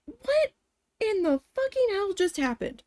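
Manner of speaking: high, stunned exclamation; bewildered. Retold or imitated. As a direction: exclaim in high-pitched disbelief. Acted bewildered reaction.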